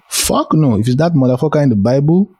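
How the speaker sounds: high-pitched, incredulous mock outrage; emphatic refusal. Speaker answering whether she'd worship that God, emphatic refusal.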